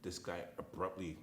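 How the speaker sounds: ordinary talk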